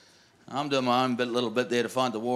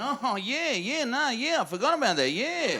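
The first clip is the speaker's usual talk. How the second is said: mock realisation; 9 semitones higher than usual. Mock realisation, playing along.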